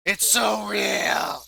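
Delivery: slow, drawn-out shout of a gamer lost in the game; mock obsessed gamer. Gamer mocking cafe players, slow dramatic shout. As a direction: stretch it out like a player who has lost touch with reality.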